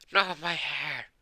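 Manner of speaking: high, drawn-out mock wail; slow, high-pitched lament; dismayed. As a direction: stretch it out in comic dismay. He discovers his own messy hair.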